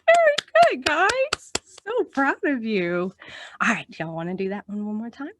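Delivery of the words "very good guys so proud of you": high, loud praise; excited praise. Real, not acted. Teacher praising children after singing, excited.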